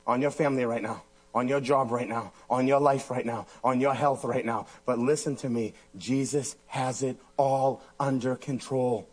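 low, steady, hushed assurance; reassuring preaching. Real, not acted. Preacher comforting people whose waves are crashing, low reassurance. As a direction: drop low and let each phrase settle.

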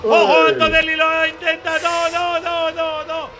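urgent call of a late attack; rising alarm at a last-gasp move; excited. Real, not acted. Last-corner overtake attempt.

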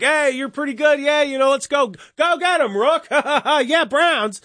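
high-pitched mock cheering, breaking into laughs; cheering fans. Fans calling to a player running down there, high mock cheering.

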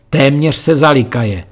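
usual speaking voice

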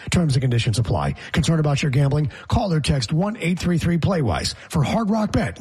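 normal talking voice

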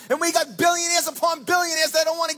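fiery sermon climax; preaching at full force. Acted. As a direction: build to a shout against the greedy. Preacher building to a shout.

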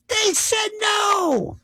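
high, drawn-out exclamation; emphatic outcry. Acted. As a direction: cry it out in a high, stretched voice. Emphatic climax, generals' verdict.

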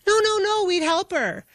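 high-pitched imitation of hurried denial; hasty reassurance. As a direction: mimic people backtracking fast, pitch jumping up. Retold townspeople hastily backing down.

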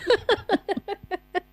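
high, manic giggle; real fit of high giggles. Real, not acted. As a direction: burst into a long manic giggle. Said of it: "I sound like a manic person in that giggle".

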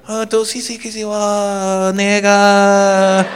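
put-on stretched sing-song kid gibberish; mock kid babble. Kid babbling to his mom at the counter, mock sing-song.

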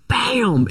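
high, punchy mock crash noise; cartoon bang; imitated smash. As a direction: slam the word out like a hit. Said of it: "And he's like".

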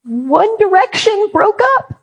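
put-on high whiny teen fan wail; sobbing teen girls. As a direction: mimic heartbroken teen girls crying out. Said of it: "And they go".

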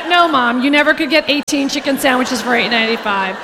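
ordinary talk

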